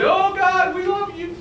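high, overdone plea of the wicked; high sing-song fake devotion; mock pleading. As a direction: ham up a fake declaration of love to God. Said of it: "They're gonna say".

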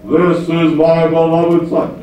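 slow, put-on voice of God; mock heavenly proclamation, drawn out. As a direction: intone it slowly from out of the blue. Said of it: "And then the voice says".

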